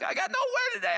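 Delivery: high, strained mock complaint; frustrated young preacher. Telling his wife Beck of a bad day, whining. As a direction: whine it like a worn-out young preacher. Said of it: "say to Beck".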